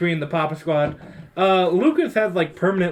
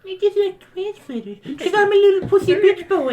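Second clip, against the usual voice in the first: mocking whiny voice; 13 semitones higher than usual. Mocking a ranch lover.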